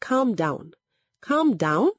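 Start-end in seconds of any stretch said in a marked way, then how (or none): 1.2-1.9 high, indignant rising echo; narrator's mildly shouted retort; shouted question. A narrator's reading. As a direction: fling the words back as an outraged question. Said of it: "Egodi shouted".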